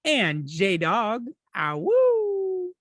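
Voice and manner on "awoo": high drawn-out awoo howl; wolf howl. Acted. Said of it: "I like doing the little awoo".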